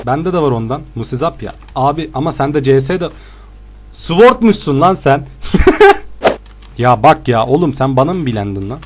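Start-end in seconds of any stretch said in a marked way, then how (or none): 3.9-5.3 teasing accusation. Real, not acted. Mock accusing opponent. 5.3-6.4 laughter jumping way up into a squeal; shrill squeal of laughter. Real, not acted. Mocking the opponent's build, squealing laugh.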